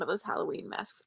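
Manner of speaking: unvoiced joking whisper. Real, not acted. Streamer joking to chat about masks in a whisper. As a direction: drop to a whisper for the joke.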